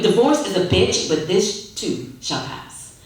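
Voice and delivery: ordinary delivery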